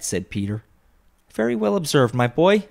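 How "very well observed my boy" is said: high, hearty praise from father; delighted cry. A narrator's reading. Bob praises his son, crying out heartily. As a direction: exclaim with pitch well raised. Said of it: "cried Bob".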